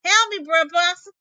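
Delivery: high, loud call from afar; distant cry for help. Acted. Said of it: "heard something".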